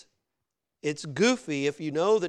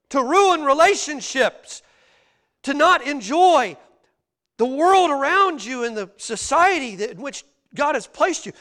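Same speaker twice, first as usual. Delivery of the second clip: high, raised preacher's voice; impassioned preaching; 10 semitones higher, 10 dB louder than usual. Real, not acted. Preacher presses congregation that more isn't better, impassioned. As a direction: press the question, then punch the answer.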